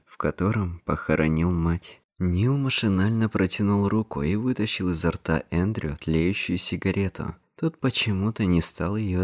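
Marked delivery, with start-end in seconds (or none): none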